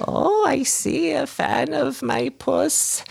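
very high, crooning witch voice; cackling old hag. Acted. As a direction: sweet-talk the cat like a creepy old hag. Hag greets the visitor.

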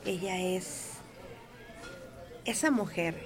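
ordinary talk

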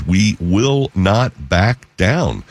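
WE WILL NOT BACK DOWN slowly, word by word; weight each word of "We will not back down"; emphatic quote. Retold or imitated. Host reading out the governor's vow, "We will not back down" slow and emphatic.

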